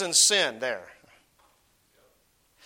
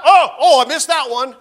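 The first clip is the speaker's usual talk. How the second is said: mock dismay, higher and louder; 4 semitones higher, 7 dB louder than usual. Preacher acting David missing the exit, mock dismay.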